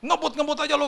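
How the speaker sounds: high-pitched mock telling-off; angry bystander scolding. Bystanders blaming the rider for speeding, angry scolding. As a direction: throw it out like an irate onlooker. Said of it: "Dia marah-marahin lah sama orang-orang".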